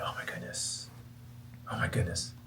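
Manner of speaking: hushed whispered awe; awed whisper. Retold or imitated. Realising his mistake in a soft awed whisper. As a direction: breathe it out in amazement.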